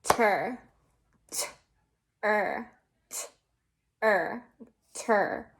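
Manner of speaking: "T-er" split into T and er, then "Ter" said whole; teacher sounding out ter; sounding out a syllable. Shown as a demonstration. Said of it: "Now we need".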